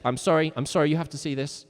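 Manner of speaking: ordinary delivery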